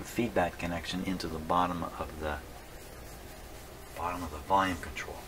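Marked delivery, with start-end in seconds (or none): none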